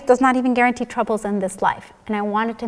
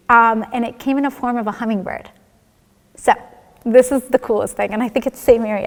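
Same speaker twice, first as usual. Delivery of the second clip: ordinary talk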